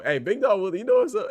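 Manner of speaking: high, loud hype call; excited shout-out. Real, not acted. Streamer hyping a chat member, loud excited shout-out. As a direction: call him out like greeting a friend across the room.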